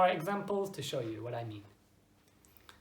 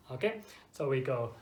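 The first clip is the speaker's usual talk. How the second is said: normal talking voice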